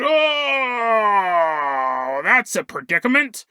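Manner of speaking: long sliding groan into mock-cartoon dismay; lightly put-on funny cartoon voice. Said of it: "I'm sure he said in a funny voice".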